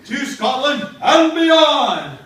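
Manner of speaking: loud, high, long-held shout; heroic battle cry. Acted. Macbeth settles for Scotland, drawn-out heroic shout. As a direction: bellow it out like a hammed-up hero. Macbeth proclaims his catchphrase.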